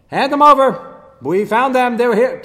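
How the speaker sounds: loud, high barking order; the king's men shouting the demand at Rachav. Acted. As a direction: bark the demand like a soldier at the door.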